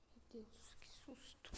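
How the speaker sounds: hushed, breathless whisper of fright; scared whisper. Real, not acted. Youtuber creeping through a haunted house, whispering. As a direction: whisper so nothing hears you.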